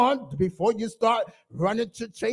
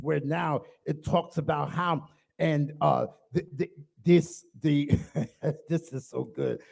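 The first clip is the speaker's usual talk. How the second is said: ordinary delivery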